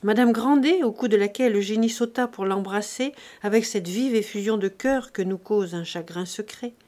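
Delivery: usual speaking voice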